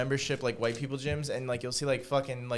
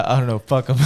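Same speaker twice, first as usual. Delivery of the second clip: laughing quote; 2 semitones higher, 6 dB louder, faster than usual. Retold or imitated. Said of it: "He goes".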